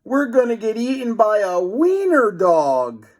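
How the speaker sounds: high, loud squeaky panic; boy about to be eaten by a dog; panicked. A narrator's reading. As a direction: wail in tiny terror. Chased by dog.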